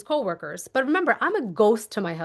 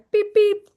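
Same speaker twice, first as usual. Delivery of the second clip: car beep sound; 10 semitones higher, 6 dB louder than usual. Shown as a demonstration. Imitating car unlock.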